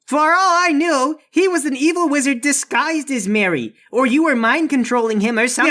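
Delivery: high, agitated guard voice; defensive door guard. Acted. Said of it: "The door guard says".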